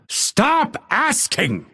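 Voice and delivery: high, slow irritated cry; exasperated command. Acted. Fighter fed up with requests, exasperated cry. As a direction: draw it out, fed up. Losing patience when asked.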